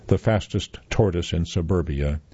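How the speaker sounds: usual speaking voice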